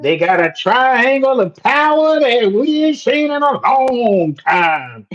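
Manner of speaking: high, hammed-up Texas twang; horrible Texas accent. Host boasting of Texas power triangle, horrible put-on Texas accent. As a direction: lay on a bad Texas accent thick to the last word. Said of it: "that was a horrible accent".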